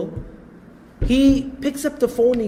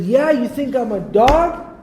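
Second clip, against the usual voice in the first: mock-indignant comeback, higher and drawn out; indignant retort; 5 semitones higher, 3 dB louder, slower than usual. Co-worker answering an insult on the phone, mock indignant. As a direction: throw back the insult as a challenge, pitch climbing.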